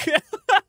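high squealing laugh; high giggle. Real, not acted. Streamer laughing at a poster, high giggle.

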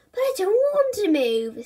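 high, whiny protest. A narrator's reading. Bertie objects to his parents, whining. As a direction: complain like a stubborn kid.

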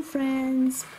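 usual speaking voice

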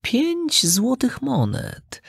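slow, high feline mewl; meowing cat. A narrator's reading. Cat marvelling at gold coins, drawn-out meowing. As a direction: stretch the words into a meow. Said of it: "zamiauczał kot".

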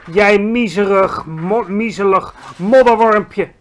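slow, loud, contemptuous giant; shouted insult. A narrator's reading. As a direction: draw out each insult at the smaller giant. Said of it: "riep hij".

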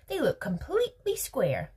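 high-pitched emphatic contradiction; insistent objection. A narrator's reading. Veruca insists in a quarrel.

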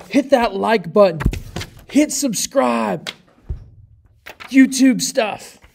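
high, slow, hyped YouTuber hype. Retold or imitated. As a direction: play the overexcited YouTube host, drawn out. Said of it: "You wanna be, be like".

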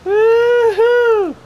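loud high whoop; excited whoop. Real, not acted. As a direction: whoop it up in triumph. Cheering after the chug.

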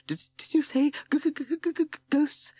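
high toad voice stuck on the g of ghosts; frightened stammer. A narrator's reading. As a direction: stammer in terror, pitched very high. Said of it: "he stammered".

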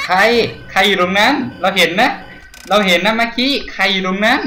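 loud, high challenge into the dark; nervous shout, pitched high; calling out nervously. Real, not acted. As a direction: call out to whoever is there. Calling out to unseen threat.

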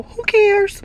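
high-pitched carefree squeal. Real, not acted. Carefree shrug about cheap shoes.

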